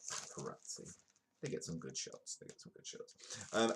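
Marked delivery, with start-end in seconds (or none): 0.1-0.9 hushed whispered aside. Acted. Vlogger sneering at the paparazzi, whispered. 2.4-3.1 whispered, voiceless repeat. Acted. Vlogger repeating the paparazzi joke as a whisper.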